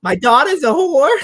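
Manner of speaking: very high, loud shriek; mock shrill mother. Hosts quoting the movie mother, high loud shriek. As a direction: screech it like the film's mother.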